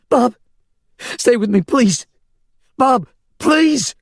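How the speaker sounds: high, loud begging cry; woman pleading with dying man, crying out; desperate pleading. A narrator's reading. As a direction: beg him not to go.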